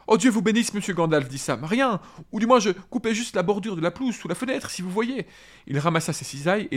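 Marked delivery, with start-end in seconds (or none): 0.1-1.3 higher, louder startled gardener; a flustered servant caught out; startled Sam. A narrator's reading. Reads Sam's lines. 1.7-5.3 higher voice of a flustered gardener; flustered Sam. A narrator's reading. Reads Sam's lines.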